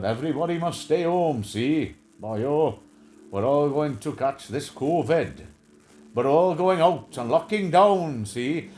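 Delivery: slowed, lightly voiced Welsh lilt; mocking the Welsh minister's lockdown warning in a lightly slowed Welsh accent. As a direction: imitate the Welsh leader announcing doom, boyo. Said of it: "the Welsh guy".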